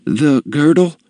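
put-on high, timid shop girl; flustered, fearful. A narrator's reading. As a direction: question her nervously in a high voice. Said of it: "The girl grew flustered, for she feared".

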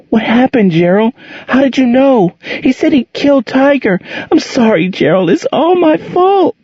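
put-on a scared child blaming herself, close to panic; almost hysterical. A narrator's reading. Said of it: "Angel was almost hysterical".

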